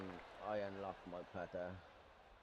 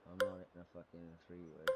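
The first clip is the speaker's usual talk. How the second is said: ordinary talk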